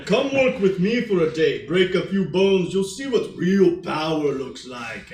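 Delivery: put-on very loud, accented strongman boast; Arnold Schwarzenegger. Game master as brother Anton bragging, Schwarzenegger-like accent. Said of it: "sound a little bit more like Arnold Schwarzenegger".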